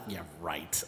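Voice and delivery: low, quiet "as if"; sceptical dismissal. Retold or imitated. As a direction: mutter it under your breath, unconvinced. Scornful disbelief at the caller's promise.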